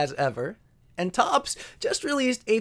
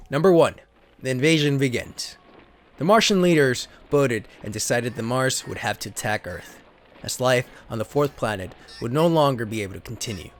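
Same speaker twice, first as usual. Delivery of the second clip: lower, steady storybook narrator voice; story narration; 7 semitones lower than usual. Acted. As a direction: read the card like an old sci-fi serial. Reads card story as narration.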